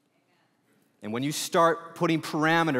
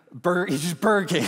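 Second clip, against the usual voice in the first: mock eager Burger King order; lightly voiced eager ex-prisoner; eager food pick; 3 semitones higher, 4 dB louder, faster than usual. Released prisoner naming his first meal, eagerly. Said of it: "He's like".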